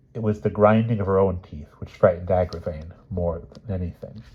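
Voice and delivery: usual speaking voice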